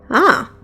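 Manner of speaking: short voiced roar; dragon roar. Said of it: "it roared".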